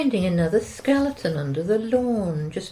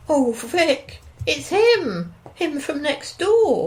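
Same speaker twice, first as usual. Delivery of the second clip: alarmed and higher, a bit louder; flustered alarm at the window; startled wife spotting the neighbours; 9 semitones higher, 3 dB louder, slower than usual. Acted.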